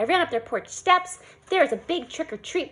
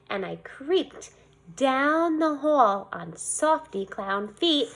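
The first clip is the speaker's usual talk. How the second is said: usual speaking voice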